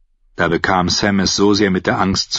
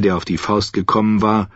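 usual speaking voice

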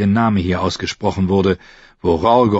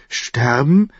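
put-on high, rising girl's query; confused question; 6 semitones higher than usual. A narrator's reading. Girl hearing the man must die, puzzled. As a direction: ask it puzzled, pitch rising. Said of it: "fragte sie verwirrt".

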